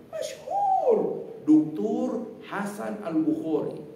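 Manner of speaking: answering local. Retold or imitated. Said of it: "Kata dia".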